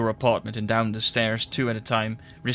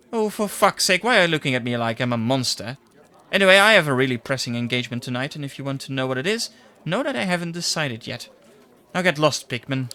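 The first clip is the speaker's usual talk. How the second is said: irritated secretary; 2 semitones higher, 4 dB louder, faster than usual. Acted. Secretary snapping at the reporter to get lost, fast and irritated. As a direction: brush him off with a sharp, fast snap.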